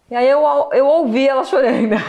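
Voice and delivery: a broken laugh over the memory; tearful laugh; nervous laugh. Real, not acted. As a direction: laugh to hold back tears. Emotional laugh recalling mother crying.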